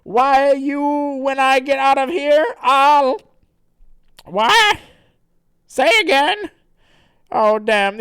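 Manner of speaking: very high, loud sputtering threat; hammed-up shouting, with halting interruptions; cartoon blustering. Character threatens then mishears, loud and blustering. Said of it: "And he says".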